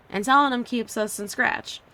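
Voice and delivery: usual speaking voice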